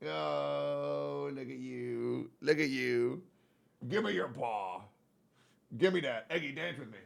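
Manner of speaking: dote quietly on the cat; tender cooing. Real, not acted. Owner asking Eggy to dance, soft cooing.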